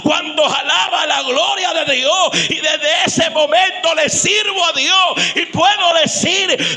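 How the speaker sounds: loud, slow, high shouted testimony; shouted prayer to God becoming sermon climax; preaching at full force. Acted. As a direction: pour it out over the congregation.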